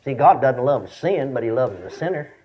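normal talking voice

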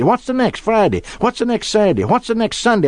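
put-on high child's questions fired one after another; three-year-old son. Son running through the days with his father, childlike insistence. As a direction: imitate a small boy relentlessly asking. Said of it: "our son was just three years old".

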